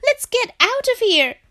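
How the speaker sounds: high, loud Frog cry; panicked shout. A narrator's reading. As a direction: urge escape in a high-pitched yell. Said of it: "shouted Frog".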